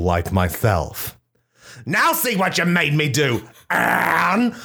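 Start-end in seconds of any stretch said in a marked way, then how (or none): 1.8-4.5 mock sob. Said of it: "I sobbed".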